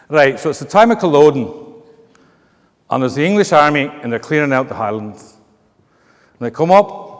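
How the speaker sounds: lilting Scottish storyteller; Scottish accent. Acted. Telling a Culloden joke to the room, Scottish accent. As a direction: tell it in a put-on Scottish brogue. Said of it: "with a Scottish accent".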